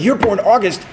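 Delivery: high mock declaration of fate; imitating an eager colleague; excited friend. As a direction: announce the coincidence triumphantly. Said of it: "He's like".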